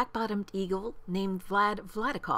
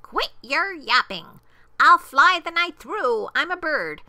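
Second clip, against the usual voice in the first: loud, high, defiant eagle; punchy, exclaimed bird's brag; taunting call back; 7 semitones higher, 9 dB louder than usual. A narrator's reading. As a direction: holler a boastful taunt over your shoulder. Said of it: "over his shoulder called back".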